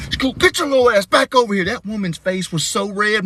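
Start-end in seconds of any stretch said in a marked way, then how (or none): 0.1-1.6 high, urgent call to come back; panicked scolding. Acted. Dad calling daughter back from stranger, mortified. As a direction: call the child back in mock alarm.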